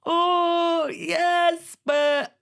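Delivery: high, loud squealing yes; friend's excited squeal. Retold or imitated. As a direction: gush then catch yourself on but. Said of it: "my friend was like".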